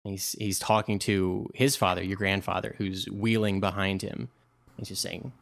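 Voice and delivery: normal talking voice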